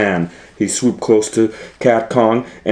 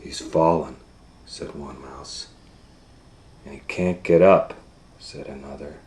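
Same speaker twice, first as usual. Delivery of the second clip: soft low murmured lines; hushed mice; 8 semitones lower, 8 dB quieter than usual. Acted. Mice murmuring over the fallen cat. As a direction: murmur them like mourners.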